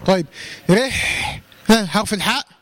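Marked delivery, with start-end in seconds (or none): 0.7-1.4 رح with a light r and a long airy ح; breathy haa. Shown as a demonstration. Teacher demonstrating the letter haa, whispered airflow on its end. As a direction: let the breath run through the ح of رح. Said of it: "الهمس".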